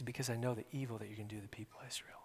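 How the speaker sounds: hushed rushed whisper; whispered prophecy. Acted. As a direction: breathe Elisha's words fast. Elisha's grieving words, whispered.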